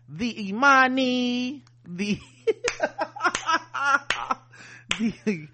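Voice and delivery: slow, high, drawn-out name; mock grand ship name. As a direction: announce it like a proud ship name.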